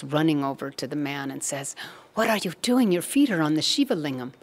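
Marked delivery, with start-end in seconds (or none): 2.1-4.3 high-pitched, alarmed reproach; shocked scolding. Retold or imitated. Namdev rushing to the man on the floor, shocked.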